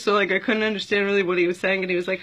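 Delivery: ordinary delivery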